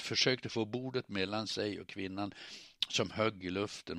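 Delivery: ordinary delivery, nothing marked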